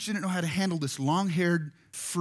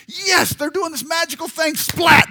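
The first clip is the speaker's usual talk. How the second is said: much higher and louder, rising to a burst on splat; excited cheering; 11 semitones higher, 8 dB louder than usual. Pretend. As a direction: cheer it, then crash. Cheering toddler's first steps.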